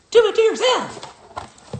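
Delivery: high, drawn-out mocking squeak; slow mock squeak. Repeating the viewer's taunt in a slow squeak.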